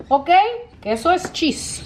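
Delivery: ordinary delivery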